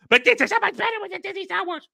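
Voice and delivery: very high, fast, hammed-up gushing; mock shill excitement. Streamer mimics shills pimping Disney Star Wars, mock excitement. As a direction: squeal it like an overexcited fake fan. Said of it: "they're so excited".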